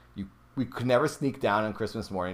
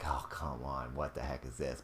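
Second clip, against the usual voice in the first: muttering annoyance; 8 semitones lower, 12 dB quieter than usual. Retold or imitated. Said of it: "I was like".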